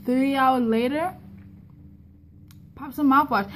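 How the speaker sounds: ordinary talk, nothing marked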